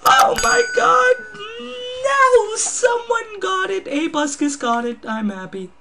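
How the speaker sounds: high, loud frantic wail; hammed-up high-pitched panic; panicking Batman. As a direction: shriek it like a hammed-up hero in distress. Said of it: "And then he's like".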